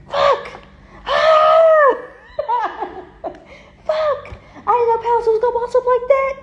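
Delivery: high, laughing mock dismay; squealing mock regret from a jealous rival; mocking the haters. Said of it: "And people are like".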